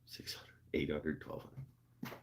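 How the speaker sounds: numbers fading to a whisper; trailing whisper. Real, not acted. Reading wheel grits to chat, fading into a whisper. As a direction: let the list die away into breath.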